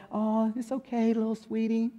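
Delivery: soft, cooing mother's voice; cooing mom. Retold or imitated. Mom soothing a kid who fell, soft cooing voice. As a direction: croon it like a doting mom to a hurt child. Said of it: "I don't know any mom who talks like that".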